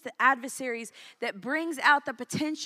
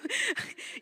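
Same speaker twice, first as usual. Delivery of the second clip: breathy laugh; 11 semitones higher than usual. Real, not acted. Laughs at her own joke.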